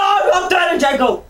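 high-pitched, shouted protest; insistent shout. Pretend. Co-hosts squabbling over who does the jingle, insistent shout.

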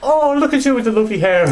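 squeaky tipsy woman asking a name; high drunk lady voice. Retold or imitated. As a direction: pitch way up like a tipsy auntie.

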